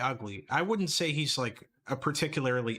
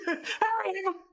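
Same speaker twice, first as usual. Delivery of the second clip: mocking high squeal. Mimicking the angry toy collector.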